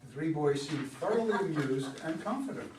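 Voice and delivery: ordinary talk, nothing marked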